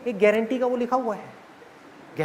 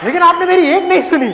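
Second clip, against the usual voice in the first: higher, louder complaint; whining daughter; 7 semitones higher, 5 dB louder than usual. Acted. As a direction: wail it like a sulking bride. Daughter blames father. (पिता को दोष देती बेटी की ऊँची, शिकायती आवाज़)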